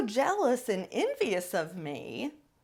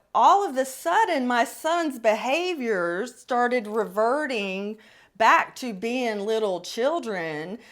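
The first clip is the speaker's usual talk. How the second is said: ordinary talk, nothing marked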